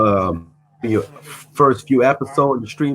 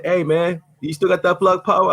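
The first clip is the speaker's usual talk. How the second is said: higher, lightly voiced friend asking; imitating his friend; 8 semitones higher than usual. Retelling a friend's message about the stock, mild imitation. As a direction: toss off the buddy's question in a raised voice. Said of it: "boy hit me up, said".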